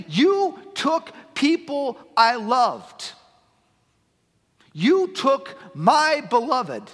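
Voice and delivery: slow, loud and high accusation; confronting death. Acted. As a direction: spit each word slowly into death's face. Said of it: "getting in the face of death itself and saying".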